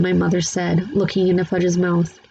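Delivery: ordinary delivery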